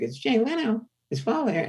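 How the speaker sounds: high-pitched talk-show host impression; Jay Leno. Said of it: "doing a Jay Leno impression".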